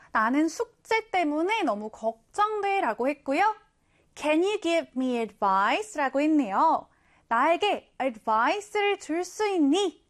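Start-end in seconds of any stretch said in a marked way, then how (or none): 4.1-6.0 I'm worried about my homework, read very slowly; slow example read. Shown as a demonstration. Teacher reading the example sentence slowly. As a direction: give each word its own space. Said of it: "예문을 한번 볼게요".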